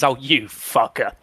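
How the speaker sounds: slow, fond teasing tone; affectionate insult. Shown as a demonstration. To friends who did something stupid, fond slow teasing. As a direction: tease a mate gently, drawn out. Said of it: "a term of endearment".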